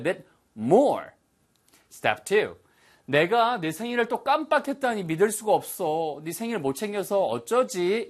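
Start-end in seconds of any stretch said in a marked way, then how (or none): 3.1-8.1 higher, flustered at forgetting a birthday; teacher acting out the feeling for students; dismayed self-reproach. Shown as a demonstration. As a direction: sound genuinely upset with yourself. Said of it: "이런 식의 느낌이라고 말씀드렸어요".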